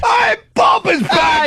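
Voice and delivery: high, drawn-out mock-English holler; horrible English accent. Host welcoming the intern back, shouted in a bad English accent. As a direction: hail him in a gleefully bad English accent. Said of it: "I wanna acknowledge I'm horrible at it".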